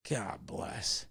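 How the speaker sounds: breathy whispered retold blessing; whispered aside. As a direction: breathe it out in awe. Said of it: "And I was like".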